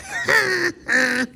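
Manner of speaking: squeaky giggle at own joke; high giggle. Real, not acted. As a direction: laugh high and bright.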